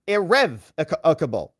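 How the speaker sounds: irrevocable slowly, stressed on REV; judge's pronunciation. Retold or imitated. Judge showing how it is pronounced, irrevocable with REV stressed. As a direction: spell out ir-REV-ocable by ear, slowly. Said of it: "I think it's pronounced irrevocable".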